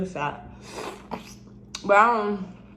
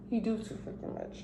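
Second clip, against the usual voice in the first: whisper; 3 semitones higher, 12 dB quieter than usual. Real, not acted. Repeating the complaint under her breath.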